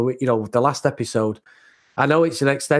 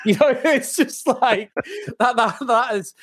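laughing; 9 semitones higher than usual. Real, not acted.

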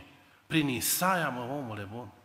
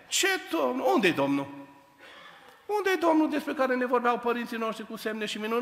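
doubting Gedeon; 7 semitones higher, 3 dB louder than usual. Acted.

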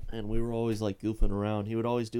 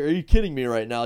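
louder, indignant disbelief; retold outburst, raised voice; mock outrage; 9 dB louder than usual. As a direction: play the kid who can't believe he's in trouble. Classmate sent out, voiced indignantly.

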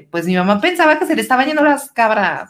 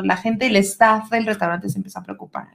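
normal talking voice

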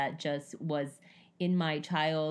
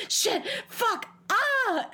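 high, sudden yelp of pain, cursing; 5 dB louder, much slower than usual. Retold or imitated. Clumsy wife hurting herself at home, high pained yelp. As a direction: yelp like you just stubbed your toe. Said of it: "I'm walking around the house going".

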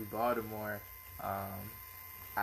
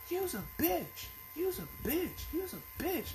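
mock screaming; 4 dB quieter than usual. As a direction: imitate a screaming girl in a raised, high voice. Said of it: "She's screaming at the top of her lungs".

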